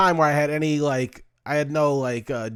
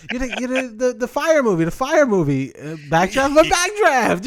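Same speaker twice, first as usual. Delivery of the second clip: high, loud starstruck babbling; fumbling star-struck gushing; flustered stammer; 9 semitones higher, 3 dB louder than usual. Retold or imitated. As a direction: stumble over the words like a dazzled fan. Flustered fan stammering at actor.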